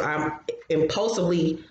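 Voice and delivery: ordinary delivery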